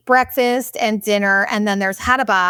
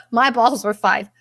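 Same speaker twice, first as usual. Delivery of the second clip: ordinary delivery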